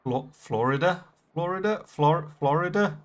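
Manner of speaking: "Florida" as FLOR-i-da, each one rising like a question; unsure pronunciation. Shown as a demonstration. Streamer questioning how he says "Florida", faltering, rising FLOR-i-da. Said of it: "you got me questioning how I say it".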